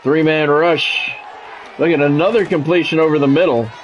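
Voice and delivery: usual speaking voice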